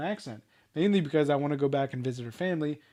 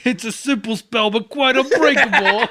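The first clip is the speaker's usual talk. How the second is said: loud, high mock Brooklyn accent; 7 semitones higher, 8 dB louder than usual. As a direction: ham up the Brooklyn accent, building to a laugh. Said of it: "no one sounds like that in Brooklyn".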